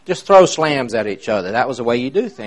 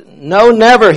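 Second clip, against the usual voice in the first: emphatic; 9 semitones higher, 9 dB louder, slower than usual. Retold or imitated. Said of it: "he said".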